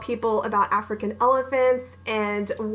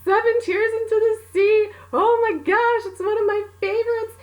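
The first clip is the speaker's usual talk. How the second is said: high, loud, gushing fan squeal; fangirling; 12 semitones higher, 3 dB louder than usual. Retold or imitated. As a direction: gush like a starstruck fan.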